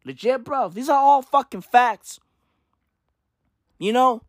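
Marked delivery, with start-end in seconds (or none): none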